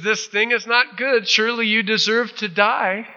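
high-pitched called-out reproach; imitated taunting shout, pitched high; mock chiding. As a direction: scold from afar, playfully. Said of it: "he chides Abner".

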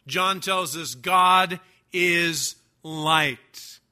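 ordinary talk, nothing marked

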